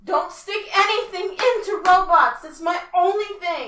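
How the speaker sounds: loud, high insistent command; emphatic shout. Real, not acted. Laying down the law to friends, loud and high. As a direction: bellow the rule at the others.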